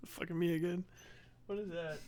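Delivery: normal talking voice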